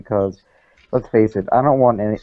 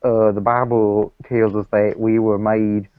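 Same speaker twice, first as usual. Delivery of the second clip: put-on Southern accent. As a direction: preach it like a hammed-up Southern creationist. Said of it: "You should work on your accent".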